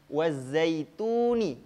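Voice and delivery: Wazaituni chanted slowly, the vowels drawn out; teacher modelling a tajwid example; Quran recitation demo. Shown as a demonstration.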